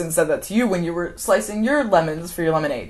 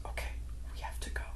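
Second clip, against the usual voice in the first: barely whispered, imitated concession; hushed false agreement; 16 dB quieter, much faster than usual. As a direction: give in very quietly, lying. Acting out his false agreement.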